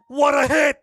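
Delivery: roaring, high, all-out yell; goal shout. Real, not acted. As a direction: scream it at the top of your lungs. Goal celebration call.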